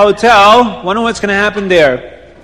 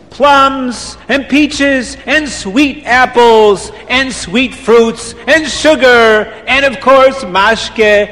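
slow list; 4 semitones higher, much slower than usual. Acted. Reb Chaim keeps listing fruits.

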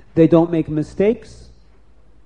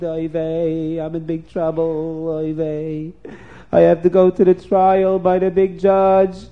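afraid; 4 dB louder than usual. Acted. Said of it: "Yankel came home very afraid".

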